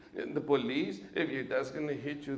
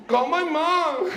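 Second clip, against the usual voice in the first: slow, high, loud, laughing; laughing request; 12 semitones higher, 10 dB louder, slower than usual. Retold or imitated. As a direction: gasp it out between laughs. Dad laughing.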